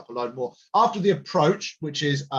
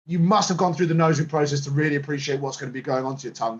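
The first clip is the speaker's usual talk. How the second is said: usual speaking voice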